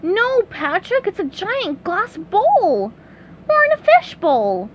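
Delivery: high, slow cartoon voice; worried SpongeBob. A narrator's reading. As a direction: break bad news to a pal in a high voice.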